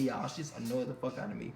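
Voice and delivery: ordinary talk, nothing marked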